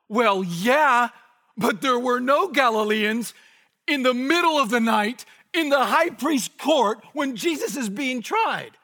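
loud, high, impassioned shout; emphatic rebuttal. Real, not acted. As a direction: hammer the objection down at full force. Heated rebuttal of an objection.

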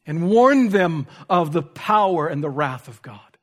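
impassioned preacher driving each point home; urgent pulpit warning; forceful preaching. Real, not acted. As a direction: preach it with punched, loud key words.

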